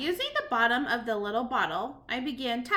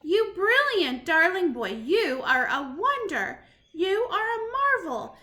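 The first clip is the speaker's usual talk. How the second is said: slow delighted praise; 6 semitones higher, 6 dB louder, slower than usual. Acted. Grandmother praises grandson.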